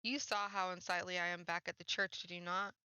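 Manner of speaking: ordinary talk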